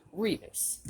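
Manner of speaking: ordinary delivery, nothing marked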